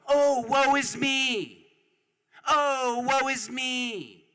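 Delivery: high, slow, pitying whine; mock whining lament. Speaker mocking the rich who cry woe is me, high and whiny. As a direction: mimic them whimpering for sympathy. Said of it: "they go".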